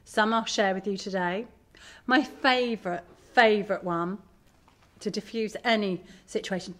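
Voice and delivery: ordinary delivery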